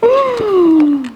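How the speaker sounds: high startled gasp; storyteller's gasp; big gasp. Acted. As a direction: gasp as if the roar is coming.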